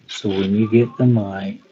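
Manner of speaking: usual speaking voice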